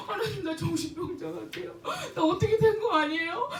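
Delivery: high, teary laugh-cry to God; half-laughing cry. Retold or imitated. Praying to God after the ATM scare, laughing through tears. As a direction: laugh and weep at once, retelling. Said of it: "막 그러면서 막 울고 있었어".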